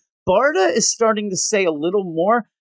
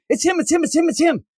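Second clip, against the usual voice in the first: high, rapid-fire excited repetition; excitedly pitched way up; 10 semitones higher, faster than usual. Acted. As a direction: act out someone too excited to stop. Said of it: "I'm just excited".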